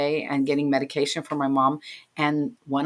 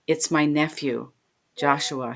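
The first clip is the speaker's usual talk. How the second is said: ordinary talk, nothing marked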